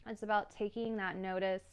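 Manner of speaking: ordinary delivery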